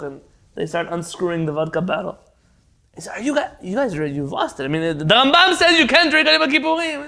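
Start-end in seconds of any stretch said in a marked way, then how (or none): 3.2-7.1 high, loud exasperated pleading. Retold or imitated. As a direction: throw up your hands at them. Leibel pleads with the Rambam.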